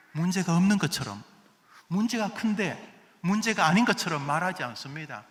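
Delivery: usual speaking voice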